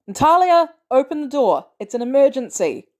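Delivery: high, loud plea, easing slightly; urgent shout. A narrator's reading. Barnes begs Natalia to open up in an emergency, shouting. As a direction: call urgently through a closed door. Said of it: "he shouts".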